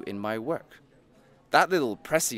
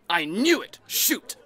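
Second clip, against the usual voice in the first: triumphant gotcha; 9 semitones higher, 3 dB louder, slower than usual. Acted. Catching the ghost's hidden motive.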